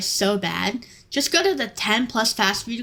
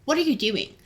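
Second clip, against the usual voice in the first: ordinary talk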